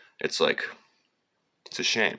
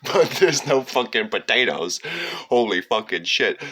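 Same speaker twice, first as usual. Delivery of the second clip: emphatic outburst; 5 semitones higher, 7 dB louder than usual. Real, not acted. Outburst about the famine.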